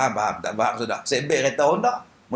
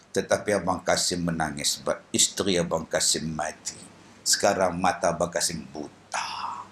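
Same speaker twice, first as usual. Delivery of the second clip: put-on a lower, softer blind old man, gently comforting; lower voice of blind Kasim; 7 dB quieter than usual. Blind Kasim consoling the weeping widow, low and soft.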